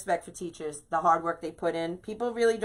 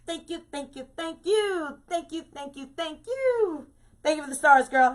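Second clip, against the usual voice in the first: gushing thanks; 9 semitones higher than usual. Real, not acted. Excited thanks for stars.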